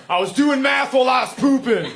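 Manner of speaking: high, loud defensive shout; aggressive yell. Retold or imitated. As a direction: yell it through the door like it's my fault. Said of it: "That's what he yelled at me, aggressively".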